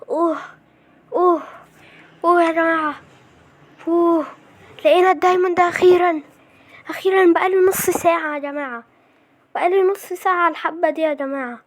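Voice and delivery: high, loud celebration easing off; relieved excitement. Real, not acted. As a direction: exult after a long search. Celebrates diamond after half an hour.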